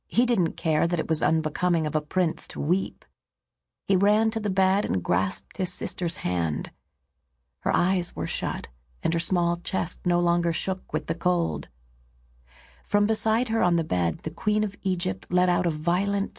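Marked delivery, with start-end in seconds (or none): none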